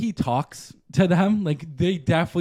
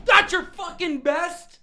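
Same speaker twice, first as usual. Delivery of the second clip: much higher, slow, sneering yell; Ramsay tirade; 5 dB louder, slower than usual. Retold or imitated. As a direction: chef ripping into a trying cook. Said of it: "So then it's like".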